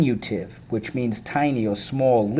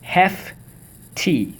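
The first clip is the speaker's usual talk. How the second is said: HEFTY in two high, separated syllables; 7 semitones higher than usual. Shown as a demonstration. Teacher leading the class through the word, syllable by syllable. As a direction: sound out HEFTY as hef, pause, tee. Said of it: "Let's go".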